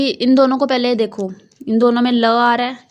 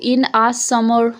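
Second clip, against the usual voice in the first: slow reading; much slower than usual. Shown as a demonstration. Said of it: "देखो".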